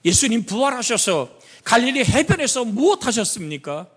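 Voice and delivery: high, storytelling sermon voice; preaching. Acted. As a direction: paint the seashore scene for the flock. Preacher narrating the gospel scene.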